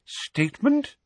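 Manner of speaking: high, questioning, puzzled; baffled, pitch lifted right up; slow bafflement. A narrator's reading. Fudge puzzled by Dumbledore's offer, high and baffled. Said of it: "said Fudge slowly".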